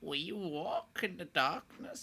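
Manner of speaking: slow, soft, eerie chant; necromancer voice. Acted. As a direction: intone it like a dark cultist. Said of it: "Do your necromancer voice".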